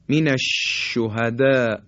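من الشهداء slow, sh spread through the mouth; slow Quranic من الشهداء with a clear sh; tajwid demo. Shown as a demonstration. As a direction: recite من الشهداء with a broad sh. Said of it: "این تلفظ حرف شین هست".

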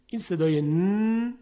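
ordinary talk

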